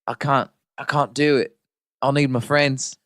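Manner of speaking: put-on a scared hobbit in a light New Zealand accent drifting to Australian; whimpering Kiwi hobbit, hammed up; mock New Zealand accent. Mocking a frightened Frodo in a put-on New Zealand accent. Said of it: "is this an Australian accent that I just delved into".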